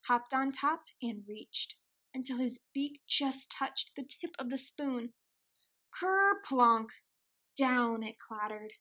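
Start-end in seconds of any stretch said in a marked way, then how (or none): 6.0-6.9 dramatic crash word. A narrator's reading. Acting out the spoon's clattering fall.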